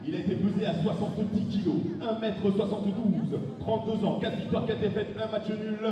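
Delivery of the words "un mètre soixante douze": ordinary delivery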